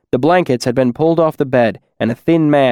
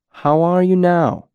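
slow, higher and gentle; 6 semitones higher than usual. Acted. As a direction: ask it gently, as if soothing someone. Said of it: "Elodin asked gently".

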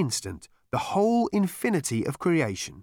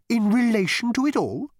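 awed Gargravar voice; 12 semitones higher, 4 dB louder than usual. Acted. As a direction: ask in awe.

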